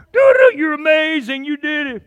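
very high, loud fanfare and cheer; hammed-up celebration. Happy phone app celebrating his walking, hammed-up cheer. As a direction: trumpet the praise in a squealing put-on voice.

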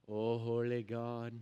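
low, slow, solemn mock prayer; overly formal prayer. Pastor mocking stiff formal prayers, low and solemn. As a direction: pray as if putting on church pomp. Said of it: "we want it to be this big, huge, formal".

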